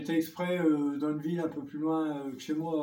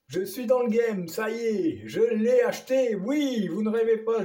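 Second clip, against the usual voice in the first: excited; 5 semitones higher, 3 dB louder than usual. Real, not acted. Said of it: "tellement content".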